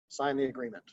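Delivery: "sign the agreement" falling at the end, low and soft; authoritative statement, pitch stepping down on "agreement"; downward inflection. Shown as a demonstration. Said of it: "that's a downward inflection, the way I'm speaking it".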